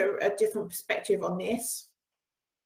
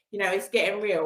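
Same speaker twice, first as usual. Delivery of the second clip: ordinary delivery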